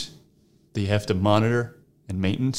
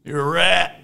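raised, higher taunt; higher-pitched jeer; mock insult; 7 semitones higher, 4 dB louder, slower than usual. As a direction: throw the insult out in mock outrage. Mock insult in acted comeback.

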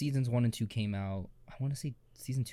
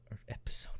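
whisper; 11 dB quieter, faster than usual. Real, not acted. Self-correction aside.

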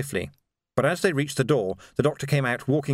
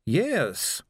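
put-on old doctor voice; 4 semitones higher than usual. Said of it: "a familiar voice, changed only a little with age".

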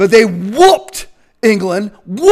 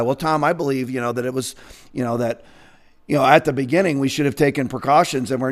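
much lower and much softer mocking mumble; mock timid waffler; 9 semitones lower, 9 dB quieter, faster than usual. Mocking a hesitant official hedging to Tom. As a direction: mumble the hedging excuses of the other side.

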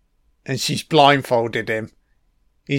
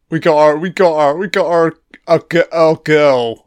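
mock cop; 2 semitones higher, 4 dB louder than usual. Stumbling mock cop line.

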